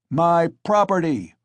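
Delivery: loud, high furious bellow; angry shout. A narrator's reading. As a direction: claim the mare in fury. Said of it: "shouted Mikolka".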